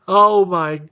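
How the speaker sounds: high, drawn-out wail of overwhelmed relief; mock sobbing. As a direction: imitate weeping survivors, gasping, slow and high. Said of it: "people are cheering and crying and hugging".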